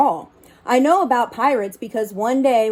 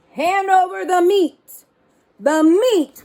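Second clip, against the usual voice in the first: higher, louder hammed-up roar; pirate roar; 7 semitones higher, 4 dB louder than usual. Crew demanding meat at the table, higher louder roar. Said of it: "we all roared".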